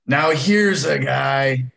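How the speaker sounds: mock announcer call, stretched and loud; Chris Collinsworth. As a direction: draw it out big like a TV commentator. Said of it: "How many times did Chris Collinsworth go".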